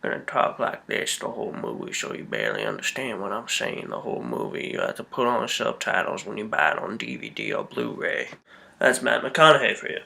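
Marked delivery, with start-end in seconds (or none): 0.0-8.3 low, mumbled Southern accent impression; mocking a Southern movie star's accent; mock southern drawl. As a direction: talk out the side of your mouth in a lazy Southern drawl. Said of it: "He's still got that stupid southern accent".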